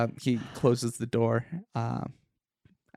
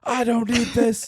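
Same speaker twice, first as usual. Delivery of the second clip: high, loud and accusing; angry raised voice; 9 semitones higher, 6 dB louder than usual. Acted. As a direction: yell at the intruder suspiciously. Tenant refuses to cooperate.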